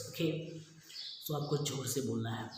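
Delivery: ordinary talk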